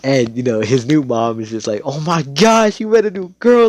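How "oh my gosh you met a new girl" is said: high, loud excited mom voice; hammed-up motherly excitement; gushing mom. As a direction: squeal like a delighted mother. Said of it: "You know how moms be".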